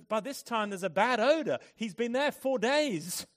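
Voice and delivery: loud, high-pitched objection; alarmed protest. Acted. Martha objects to opening her brother's tomb, loud protest. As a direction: protest it loudly, voice lifted high.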